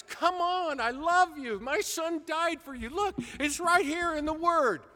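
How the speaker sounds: a loving plea that rises; Lord yelling. Retold or imitated. The Lord calling to doubting believers, pleading loudly. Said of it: "the Lord is yelling at us".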